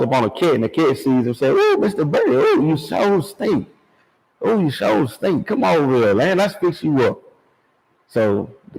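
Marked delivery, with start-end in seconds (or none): 1.6-7.2 sly cat voice. Retold or imitated. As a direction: coax the bird like a sly purring cat.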